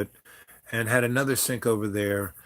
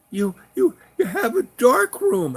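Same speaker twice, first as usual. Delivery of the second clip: amazed woman; 7 dB louder than usual. Retold or imitated. Woman seeing the darkroom, high amazed cry.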